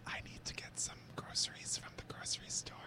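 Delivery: mock ASMR whisper of mundane chores; soft, deadpan parody whisper. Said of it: "It's more like".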